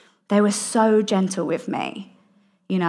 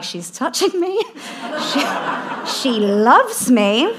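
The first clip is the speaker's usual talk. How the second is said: high squeak, then drawn-out disbelief; mock startled delight; 6 semitones higher, slower than usual. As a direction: act out being bowled over by affection. Acting out startled reaction to affection.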